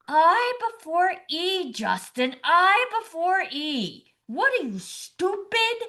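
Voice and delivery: high, drawn-out scolding yell; a mock scream climbing on the last word. Spelling bee spectator bursts out at Justin, screaming. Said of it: "and starts screaming".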